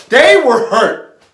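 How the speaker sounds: loud, high, anguished emphasis; hurt outcry. A narrator's reading. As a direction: cry out on behalf of the wounded family. Impassioned retelling of family's grief.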